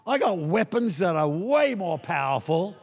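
ordinary talk, nothing marked

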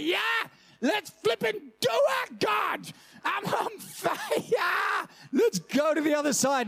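over-the-top enthusiastic believer; fired-up eager reply. Acted. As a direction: yell it like you can't wait to go. Eager believer answers God.

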